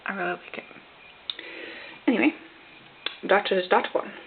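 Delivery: usual speaking voice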